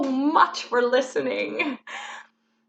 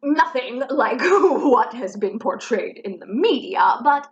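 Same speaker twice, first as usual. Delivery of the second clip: ordinary talk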